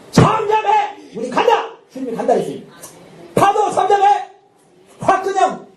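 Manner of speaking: high, sharp shouted command to the waves. Acted. Preacher commands the waves to calm, shouting. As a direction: order the storm to be still.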